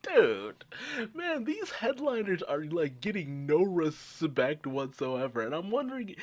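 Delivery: ordinary talk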